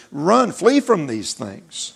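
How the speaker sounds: very slow, each word spaced out; "flee" weighted and drawn out; slow scripture emphasis. Real, not acted. Preacher slowly reading Timothy's warning to the church.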